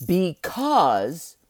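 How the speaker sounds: "Because" alone, rising and held; stress on because. Shown as a demonstration. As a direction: lift "Because" after a pause, leave it hanging. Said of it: "I emphasize the word because".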